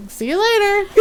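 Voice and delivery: high-pitched, airy mock farewell; sassy dismissal. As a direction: wave him off playfully.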